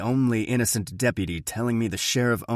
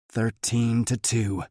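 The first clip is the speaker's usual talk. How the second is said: ordinary delivery, nothing marked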